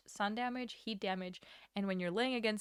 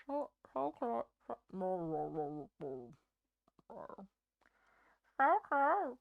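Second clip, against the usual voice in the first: stitchy voice. Shown as a demonstration. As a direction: growl it like Stitch. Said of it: "That's kinda stitchy".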